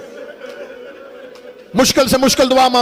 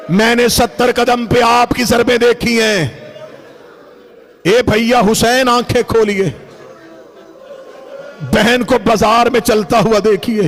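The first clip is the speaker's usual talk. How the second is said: grieving sister pleading. Acted. Zainab addressing her slain brother, restrained mournful plea. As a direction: call to him in measured, mournful phrases.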